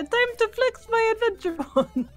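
lightly voiced heroic Mario. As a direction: say it like a proud Mario. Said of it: "Mario was like".

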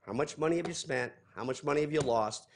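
normal talking voice

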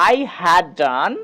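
very slow, each word spaced out; slow model sentence. Shown as a demonstration. Teacher reading the English sentence very slowly. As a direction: spell it out slowly for the class. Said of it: "তো এটাকে ইংরেজিতে বলবো".

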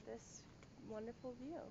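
hushed tour-guide whisper. Real, not acted. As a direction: keep it hushed, almost breath only. Hushed villa tour continues.

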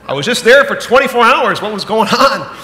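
loud, high, laughing disbelief; shocked at the bill. Retold or imitated. Father opening the hospital bill, laughing in loud disbelief. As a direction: gasp at the size of the bill. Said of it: "you go".

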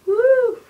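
high, loud "woo"; excited whoop. Pretend. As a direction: let out a high-pitched cheer. Cheering in play.

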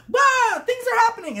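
high, loud squawk; hammed-up royal alarm; mock frantic queen.

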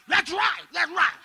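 rally to go fight; ready to fight. Retold or imitated. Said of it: "I was like".

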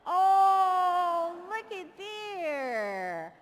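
loud, high, very slow amazed oh; mock awe. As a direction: gasp at the light for the kids. Pretending awe at the light.

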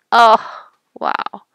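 ordinary delivery

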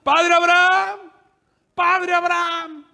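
high, drawn-out call to someone far away; long-held pleading shout across a great distance; crying out. Acted. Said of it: "desde el cielo grita".

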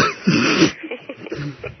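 a soft laugh of giving up; soft real laugh. Real, not acted. Operator to caller, soft helpless chuckle. Operator says even paper won't help.

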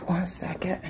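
quick whispered aside. Real, not acted. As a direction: whisper it off to the side. Aside while turning off alarm.

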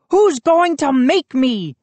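high-pitched challenge; defiant demand. A narrator's reading. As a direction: demand it like a cocky boy. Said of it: "he demanded".

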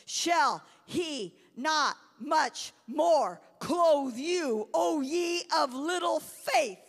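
slow, loud, stretched-out preaching; preaching climax. Acted. Preacher closing the scripture to the congregation, booming slowly. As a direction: thunder the last words of the verse.

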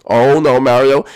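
ordinary talk

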